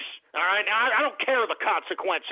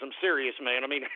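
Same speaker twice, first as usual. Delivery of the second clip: ordinary delivery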